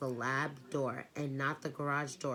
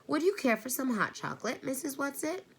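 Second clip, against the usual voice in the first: polite hostess; 10 semitones higher, 4 dB louder than usual. A narrator's reading. Mother politely offering guest chocolate.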